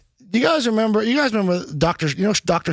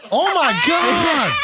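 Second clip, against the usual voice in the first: shocked shout; 10 semitones higher, slower than usual. Real, not acted. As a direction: gasp it out in shock. Seeing the veins pop.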